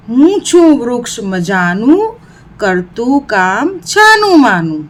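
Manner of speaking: put-on lilting, rhythmic children's verse; rising line, then a falling refrain ending; poem recitation. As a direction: recite in a sing-song for pupils.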